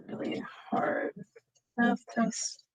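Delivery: soft, broken up as the typing goes; quiet, halting dictation to oneself; muttering while typing. Real, not acted. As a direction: murmur each bit as you write it. Types the monologue while saying it.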